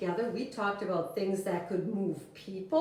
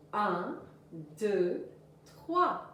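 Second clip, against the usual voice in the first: counting in; 3 semitones lower, 3 dB quieter, slower than usual. Shown as a demonstration. Teacher counting the class into the French song. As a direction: count in "Un, deux, Trois" slowly, rising on "Trois". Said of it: "let's start out with our French song".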